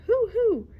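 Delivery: high loud hoot; owl hoot. Acted. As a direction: holler it like an owl. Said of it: "who hollered".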